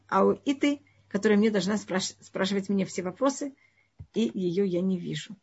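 ordinary delivery

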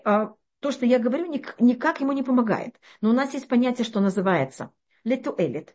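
ordinary delivery

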